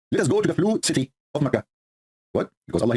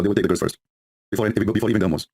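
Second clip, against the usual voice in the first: ordinary talk, nothing marked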